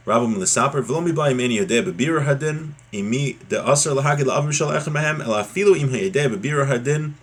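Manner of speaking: ordinary talk, nothing marked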